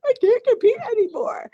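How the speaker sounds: high, tearful repeat of the confession. Retold or imitated. Retelling her breakdown to the listener, high and tearful. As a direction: say it like you are breaking down. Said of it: "I just had to break down".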